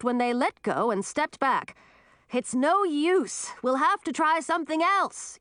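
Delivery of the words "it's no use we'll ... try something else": higher-pitched, mildly strained shout. A narrator's reading. Man giving up the lift to his helpers, shouting. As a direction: shout that the effort failed. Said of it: "Edric shouted".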